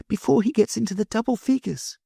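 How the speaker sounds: put-on a little girl hurrying off; high child's voice; little girl Sylvie. Sylvie aside to narrator.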